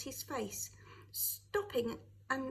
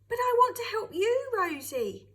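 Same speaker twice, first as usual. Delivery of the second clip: much higher and louder, calling after her; 10 semitones higher, 10 dB louder than usual. A narrator's reading. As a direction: call out to Rosie as she leaves. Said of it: "Jim called out".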